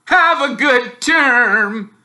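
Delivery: high, loud, slow sarcastic sweetness; grinning mock goodbye; nasty farewell. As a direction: smile nastily as you leave him. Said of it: "with an even nastier smile".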